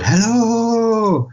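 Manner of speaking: long high "hello" held out; high, drawn-out mock holler; imitated call. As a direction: sing it out as if calling through an empty place. Mimicking the character's calling hello.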